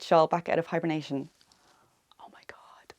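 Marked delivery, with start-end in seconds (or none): 2.2-2.9 hushed, awed whisper. Real, not acted. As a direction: breathe it out in awe. Whispered awe over the shawl.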